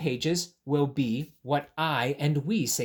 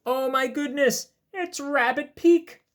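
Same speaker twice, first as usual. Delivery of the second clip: excited; 10 semitones higher, 5 dB louder than usual. Real, not acted. Excited discovery in picture book.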